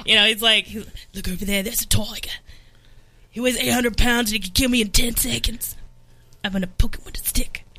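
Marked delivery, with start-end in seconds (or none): 1.1-7.6 copying a comic's mock Australian accent; Australian croc-hunter accent; Crocodile Hunter impression. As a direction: do an Australian wildlife host, hushed commentary. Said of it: "Crocodile Hunter impersonation that he does".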